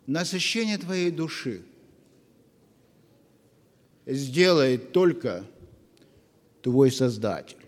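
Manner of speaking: normal talking voice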